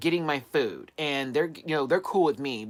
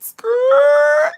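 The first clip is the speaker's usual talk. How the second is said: long, piercing high squeal; tire screech imitation; 15 dB louder than usual. Telling how the car stopped, loud drawn-out screech. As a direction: screech like skidding tires. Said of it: "the tires went".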